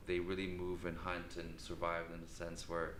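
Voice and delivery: normal talking voice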